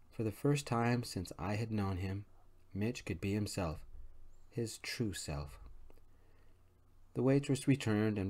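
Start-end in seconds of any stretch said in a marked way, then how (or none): none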